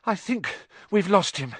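put-on puffed-out boy catching his breath; panted, out of breath. A narrator's reading. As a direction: sigh out, then speak as if winded. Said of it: "Harry panted".

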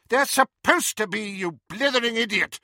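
high, loud scolding; exasperated shout. A narrator's reading. Professor rebukes the caretaker for a false alarm, shouting. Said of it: "shouted McGonagall".

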